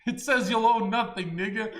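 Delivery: exasperated. Real, not acted. Exasperated rant.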